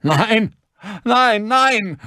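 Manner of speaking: very high, loud screams; panicked high-pitched screaming; screaming in terror. A narrator's reading. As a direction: shriek in pain and horror. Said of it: "schrie vor Schmerz und Entsetzen".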